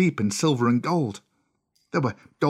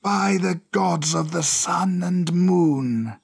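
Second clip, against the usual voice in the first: slow, higher-pitched serpent oath; crying cobra; 5 semitones higher, 3 dB louder, much slower than usual. Acted. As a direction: cry it out in long-drawn words. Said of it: "cried the cobra".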